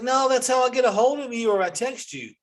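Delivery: high, exasperated explaining; pleading with a careless daughter; exasperated dad. Retold or imitated. Dad to daughter about her phone, exasperated.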